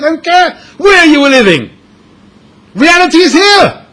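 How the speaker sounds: high, loud exasperated wife voice; scolding wife. Acted. Wife telling her husband he has lost his mind, high shrill scolding. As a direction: berate him like an incredulous wife.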